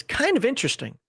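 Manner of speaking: "kind of" pushed up high in pitch; stress on KIND OF. Real, not acted. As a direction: lean hard on "kind of" as a qualifier. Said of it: "I stress the word kind of".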